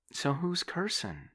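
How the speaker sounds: put-on high, loud young woman, indignant; a young woman caught off guard, protesting. Debbie voiced in the story.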